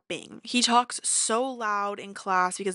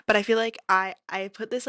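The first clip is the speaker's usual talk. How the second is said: usual speaking voice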